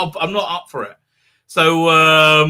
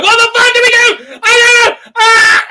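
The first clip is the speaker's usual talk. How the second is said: much higher and louder, frantic mock panic; panicking Brits; 10 dB louder than usual. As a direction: play a Brit losing it completely, yelling in alarm. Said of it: "They're like".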